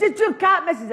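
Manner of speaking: put-on high cheeky kid bargaining; a chancer of a wee boy, pitched high. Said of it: "And he says".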